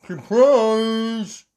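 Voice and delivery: usual speaking voice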